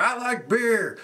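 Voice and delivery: high, silly beer-loving cowboy; goofy drunk cowboy. Retold or imitated. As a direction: ham up the drunk wrestler. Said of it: "spilling beer everywhere".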